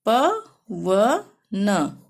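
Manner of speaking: very slow, each sound apart: प, व, न; teacher breaking पवन into its letters; syllable by syllable. Shown as a demonstration. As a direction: spell out प व न one syllable at a time. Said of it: "ये मैं अलग से बता रही हूँ".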